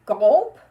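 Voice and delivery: very loud comic gulp. Acted. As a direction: gulp it big. Puppet swallowing.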